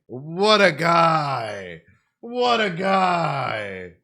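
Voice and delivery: very slow, loud stretched cheer; drawn-out shout. Real, not acted. Streamer praising Monster Kid with a slow, loud, drawn-out shout. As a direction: stretch every word out in loud admiration.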